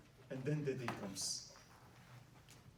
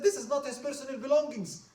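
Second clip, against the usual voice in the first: disgusted demand; 12 semitones higher, 11 dB louder than usual. Acted. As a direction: demand the wealth be seized, loud and high. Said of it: "He was so disgusted of this person".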